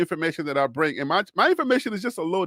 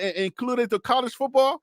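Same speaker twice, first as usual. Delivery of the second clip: normal talking voice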